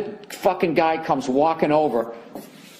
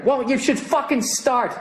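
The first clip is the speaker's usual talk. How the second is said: heated comeback; 9 semitones higher than usual. Pretend.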